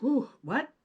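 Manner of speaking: ordinary delivery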